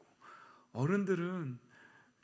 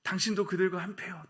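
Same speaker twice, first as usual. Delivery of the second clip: loud, raised-pitch accusation; accusing; 5 semitones higher, 6 dB louder than usual. Acted. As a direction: point the finger at Peter loudly. Acting out an accuser confronting Peter. (높고 큰 소리로 몰아세우기)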